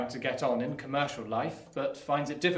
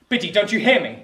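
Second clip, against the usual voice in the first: higher and louder, insistent; raised demand; 6 semitones higher, 12 dB louder than usual. Acted. Pip pressing Biddy for an answer, raised and insistent. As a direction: call her out sharply, demanding a reply.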